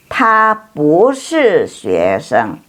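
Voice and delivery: very slow, syllable by syllable; slow model reading. Shown as a demonstration. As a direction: stretch each syllable so students can copy. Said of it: "teacher models Chinese answer".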